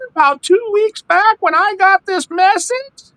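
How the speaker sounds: very high mock falsetto; hapless caller. Computer user owns up to tech support, in silly high falsetto. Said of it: "calling Levi and saying".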